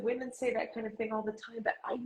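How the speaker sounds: British agent, dismissive; British accent. Retold or imitated. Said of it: "in her wonderful British accent".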